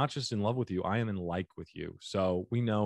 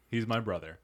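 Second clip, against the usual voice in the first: ordinary talk, nothing marked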